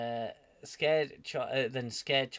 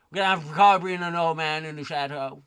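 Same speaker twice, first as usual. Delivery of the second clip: mock announcement; 3 semitones higher, 13 dB louder than usual. Mocking the writers' plans.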